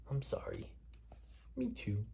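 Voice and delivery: soft unvoiced whisper; whispered apology. Parent reading the apology softly to child. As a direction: breathe it out as a secret sorry.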